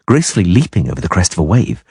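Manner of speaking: ordinary delivery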